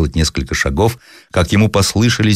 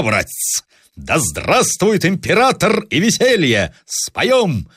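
swaggering officer; 7 semitones higher than usual. Acted. Officer to his comrade, a light cocky toast. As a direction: show off your careless French pose. Zaretsky playing a Frenchman.

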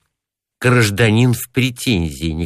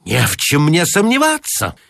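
a touch higher, offended shout; high tenor shout; 8 semitones higher than usual. A narrator's reading. Offended pedant snapping at the clerk in high tenor. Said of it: "крикнул Гарунский высоким тенором".

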